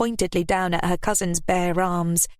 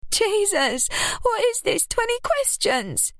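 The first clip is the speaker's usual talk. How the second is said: very high, exasperated outburst; exasperated cry. A narrator's reading. Ruby crying out at Lily's grilling. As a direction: throw up your hands at the questions. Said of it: "Ruby cried".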